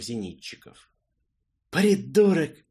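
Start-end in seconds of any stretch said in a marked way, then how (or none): 1.7-2.6 loud, high, spiteful insult; spat out angrily. Acted. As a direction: spit the word out in anger. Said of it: "злобно выплюнул Арчи".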